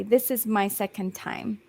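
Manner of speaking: ordinary talk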